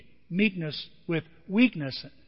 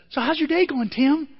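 sweet wife voice; 8 semitones higher, 3 dB louder than usual. Retold or imitated. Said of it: "Calls back ... and says".

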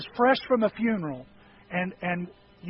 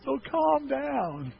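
calming doctor; 5 semitones higher, slower than usual. Retold or imitated. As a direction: talk him down gently and slowly. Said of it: "He goes".

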